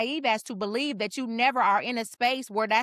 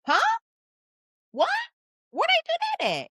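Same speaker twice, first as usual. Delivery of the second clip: mock disbelief.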